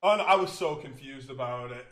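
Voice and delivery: ordinary talk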